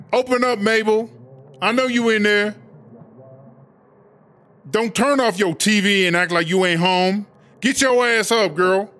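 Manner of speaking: landlady rousing Mabel through her door, calling out. Acted. Demand that she open up.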